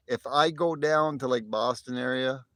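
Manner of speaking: ordinary delivery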